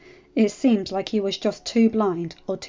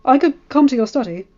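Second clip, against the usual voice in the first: put-on nervous stammering boy; hurried, trying to deflect; flustered; 3 semitones higher, 8 dB louder than usual. A narrator's reading. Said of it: "John was even more startled".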